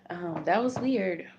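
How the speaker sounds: a real startled reaction, said out loud; startled aside. Real, not acted. Startled by something sudden.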